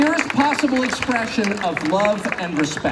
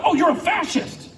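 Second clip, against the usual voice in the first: mocking accusation; 8 semitones higher than usual. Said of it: "If you were to say that".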